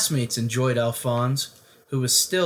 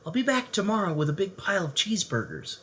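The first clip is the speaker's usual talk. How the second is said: promising; 6 semitones higher, 4 dB quieter, faster than usual. Acted. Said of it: "he promised".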